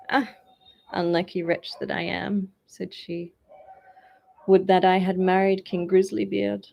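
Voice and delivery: a touch of despair; mild lament. A narrator's reading. Princess laments.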